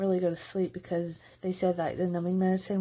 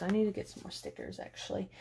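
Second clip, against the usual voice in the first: quiet aside; 9 dB quieter, faster than usual. Real, not acted. Soft aside to herself.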